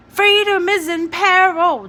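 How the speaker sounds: shouted. Acted. Jamie running ahead, shouting the poster. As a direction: yell it while running ahead.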